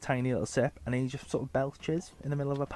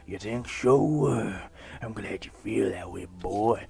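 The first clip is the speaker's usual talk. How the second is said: creepy dad voice; 4 dB louder than usual. Acted. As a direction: sound like a deeply dodgy father. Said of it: "making them both sound like they're on a register".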